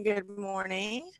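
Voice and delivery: slow, stretched and soft; drawn-out good morning. Pretend. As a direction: let the greeting linger lazily. Greeting viewers, then stretches it.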